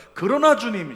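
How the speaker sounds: very loud, high proclamation; shouted confession. Shown as a demonstration. Preacher modelling the confession, loud shout. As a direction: declare it with full force. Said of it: "이렇게 고백하는 겁니다".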